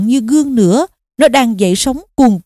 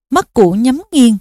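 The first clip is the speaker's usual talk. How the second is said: ordinary delivery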